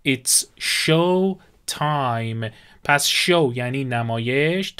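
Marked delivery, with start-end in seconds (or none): 0.1-2.5 drawn out word by word; very slow model phrase. Shown as a demonstration. Teacher models show time for students, extremely slow. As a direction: stretch every word for the learners.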